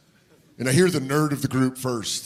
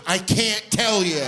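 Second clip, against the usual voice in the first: slow, higher sheepish refusal; coy refusal; 5 semitones higher, slower than usual. Retold or imitated. Kid refusing to answer the dad, slow sheepish drawl. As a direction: drag it out like a guilty kid.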